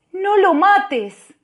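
very high, loud and slow plea; loud drawn-out plea; pleading cry. Acted. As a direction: beg her at full voice. Pleading with woman at clinic.

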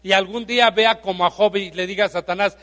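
normal talking voice

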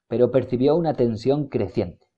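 ordinary delivery, nothing marked

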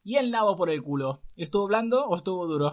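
gleeful comeback; laughing taunt. Acted. Mariquilla taunts the prince back, laughing.

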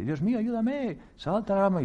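high pleading cry to God; high plea. Acted. A man hanging from a branch begging God, high pleading.

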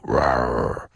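low mock tiger "rawr"; playful tiger growl. Tiger growl ending the jingle.

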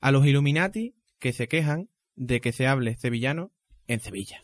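normal talking voice

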